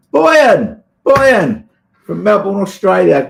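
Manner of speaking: high, loud call of welcome; joyful shout of a name; excited greeting. Real, not acted.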